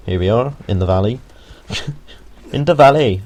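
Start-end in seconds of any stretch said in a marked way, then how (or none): 2.5-3.2 higher, louder mock Welsh lilt; bad Welsh accent. Gamer joking to viewers at the valley, bad Welsh accent. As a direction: put on a hammed-up Welsh accent. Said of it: "that was a horrible accent".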